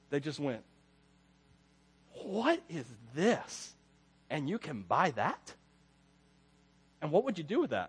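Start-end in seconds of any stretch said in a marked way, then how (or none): 2.2-7.9 awed grandparents. Retold or imitated. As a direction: marvel softly at everything. Grandparents amazed at Walmart.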